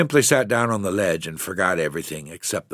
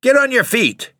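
furious order, much higher and louder; angry yell; 6 dB louder than usual. Acted. Father hauling boys to knees, furious yell. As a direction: yank them up with your voice.

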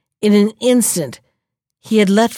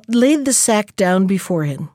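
plain reading voice

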